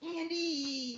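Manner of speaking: high, drawn-out put-on call; stretched high-pitched mock call; mom calling out. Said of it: "She'll be like".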